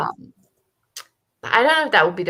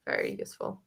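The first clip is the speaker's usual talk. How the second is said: usual speaking voice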